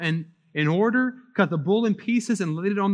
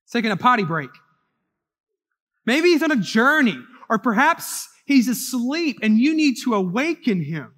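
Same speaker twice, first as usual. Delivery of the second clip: louder and higher, a sarcastic jeer; mocking taunt; 5 semitones higher, 4 dB louder than usual. A narrator's reading. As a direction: goad them to shout louder, sneering at their god. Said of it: "Elijah began to mock them".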